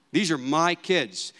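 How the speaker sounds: "my" drawn out and leaned on; stress on MY. Shown as a demonstration. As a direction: put slow, possessive emphasis on "my". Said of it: "with the emphasis on my".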